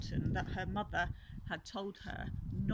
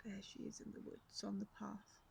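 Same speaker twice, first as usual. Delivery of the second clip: whisper; 9 dB quieter than usual. Acted. Whispered aside to child.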